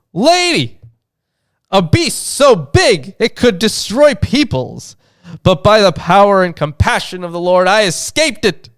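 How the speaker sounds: high-pitched, theatrical reply; dramatized visionary. Acted. Acts the narrator's reply to the lady.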